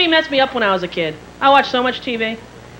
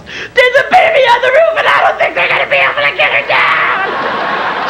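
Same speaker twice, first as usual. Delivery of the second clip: hysterical screaming; 9 semitones higher than usual. Retold or imitated. Caller phones sister about TV rescue, hysterical scream. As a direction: wail it in total panic.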